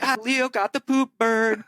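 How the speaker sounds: put-on high jeering voice; classmates' taunt. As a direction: tease like kids in class. Classmates teasing the latecomer.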